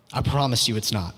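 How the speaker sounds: normal talking voice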